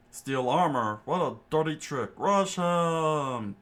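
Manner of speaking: loud, high battle cry; rally the chefs with a yell. A narrator's reading. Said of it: "Patty yelled out".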